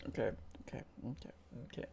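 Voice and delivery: whispered okays, fading out; whispering. Real, not acted. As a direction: hush it down to a breath. Calming himself down.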